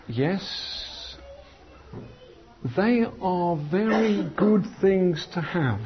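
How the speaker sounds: slow, drawn-out shopkeeper answer; slow speaker. Retold or imitated. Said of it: "it was sort of that pace".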